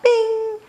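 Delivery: high ringing bing. A narrator's reading. Froggy hops on his bike, high bing. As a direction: chime it out high.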